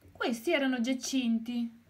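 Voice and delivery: lightly voiced happy hen; smiling. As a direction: smile through the line.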